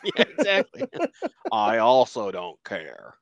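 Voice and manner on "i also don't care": low, slow, deadpan refusal; Tommy Lee Jones. Acted. Student told to go to class, low slow indifference. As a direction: drag it out low and unmoved.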